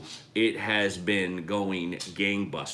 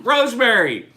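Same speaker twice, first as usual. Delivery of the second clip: distant loud shout; yelling from another room; 12 semitones higher, 8 dB louder than usual. Real, not acted. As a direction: call out from the next room. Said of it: "[name]'s yelling from the other room".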